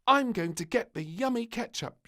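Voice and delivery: high, loud eager voice; a greedy little girl set on ketchup; bright determined piping; little princess. A narrator's reading. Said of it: "she decided".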